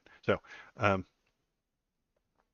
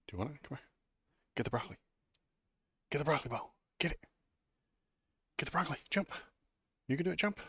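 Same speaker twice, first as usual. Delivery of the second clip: high, eager coaxing voice; high pet coaxing; 8 semitones higher, much faster than usual. Real, not acted. Owner urging his dog to grab the broccoli, high coaxing. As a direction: cheer the dog on, pitched up.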